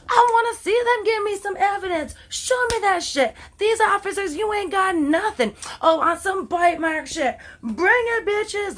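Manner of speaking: hammed-up defiant thug girl daring the cops; mock thug. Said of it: "they were full out thug".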